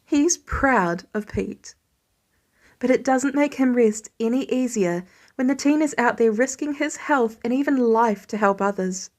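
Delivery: usual speaking voice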